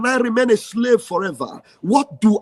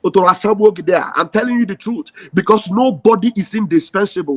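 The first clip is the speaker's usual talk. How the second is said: ordinary talk, nothing marked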